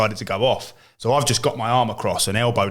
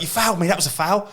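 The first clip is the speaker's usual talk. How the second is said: high, complaining; lightly mimicked hard man crying foul; whiny foul claim; 7 semitones higher than usual. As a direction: moan for the foul. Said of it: "'cause he'd be like".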